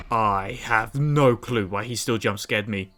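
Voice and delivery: usual speaking voice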